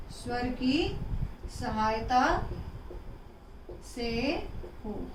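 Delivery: स्वर की सहायता से हो drawn out, word by word; slow dictation. Shown as a demonstration. Teacher dictating the definition slowly to pupils. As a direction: pace it out so the class can copy it. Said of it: "repeat for dictation".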